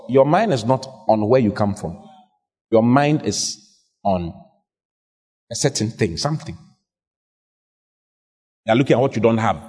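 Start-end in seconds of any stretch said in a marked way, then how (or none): none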